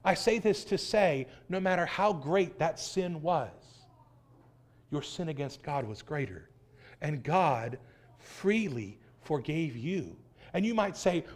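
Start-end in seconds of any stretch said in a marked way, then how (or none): none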